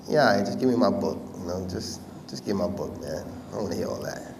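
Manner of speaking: low, soft, embarrassed brush-off; dismissive muttering. Retold or imitated. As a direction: mutter it low, trailing away to shut the praise down. Brushing off praise, wanting book back.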